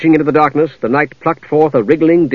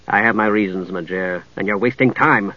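low, curt impatience; restrained impatience in a lowered voice; impatient; 6 semitones lower, 5 dB quieter than usual. Acted. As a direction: shut the question down. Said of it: "Steele said impatiently".

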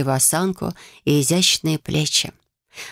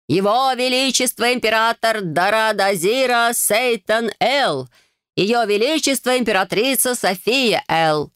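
town crier; 6 semitones higher, slower than usual. A narrator's reading. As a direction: proclaim the royal names grandly. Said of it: "Он громко и торжественно крикнул".

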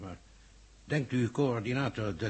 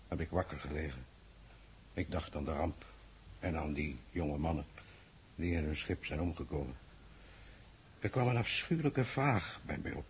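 low, hushed and heavy, confiding a sleepless night; softer, as if admitting something painful; quiet confession; 4 semitones lower, 7 dB quieter than usual. Acted. Sleepless confession, alone with Hemelaar. (zacht en bedrukt bekennend)

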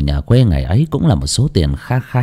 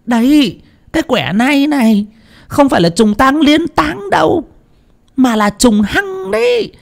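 much higher, rising into a shouted rebuke; scolding outcry. A narrator's reading. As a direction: berate her in alarm. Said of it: "hú lên một cái".

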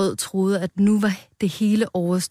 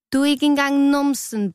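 high-pitched final taunt; mock jeering; 8 semitones higher than usual. A narrator's reading. A tomato mocks Rød once more, jeering high. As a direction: throw out a high, teasing jibe.

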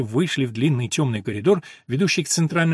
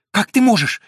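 breathed out; 7 semitones higher, 6 dB louder than usual. A narrator's reading. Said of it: "выдохнула она".

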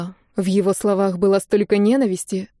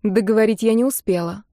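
ordinary delivery

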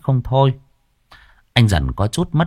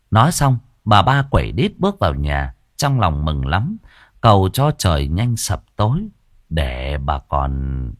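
ordinary delivery

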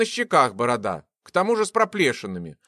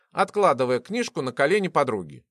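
ordinary delivery

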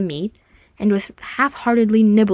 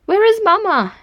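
put-on high, louder child's voice; piping little girl; 12 semitones higher, 5 dB louder than usual. A narrator's reading. Little girl asking Eowyn after her mother, piping. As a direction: pipe up in a small girl's high voice. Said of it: "she piped".

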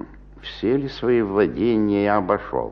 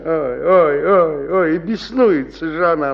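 mock lament; 8 semitones higher, 3 dB louder than usual. Colas groans mockingly about his wife.

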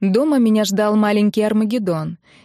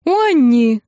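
higher, louder outburst; raised-voice call; yelling; 5 semitones higher, 6 dB louder than usual. A narrator's reading. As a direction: cry out at someone in outrage. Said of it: "заорала Нелли".

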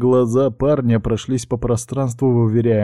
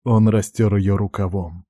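ordinary delivery

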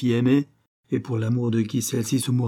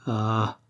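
plain reading voice

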